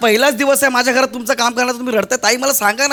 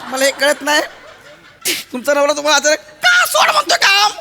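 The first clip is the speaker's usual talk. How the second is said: mock outrage; 7 semitones higher than usual. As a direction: boss hamming up confusion about the husband. Boss outraged about husband.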